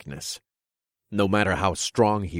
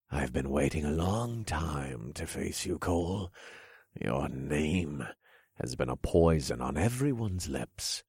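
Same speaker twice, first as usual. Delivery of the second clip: sly villain king; 3 semitones lower, 7 dB quieter than usual. Acted. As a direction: purr it out like a smug king. King voiced in story.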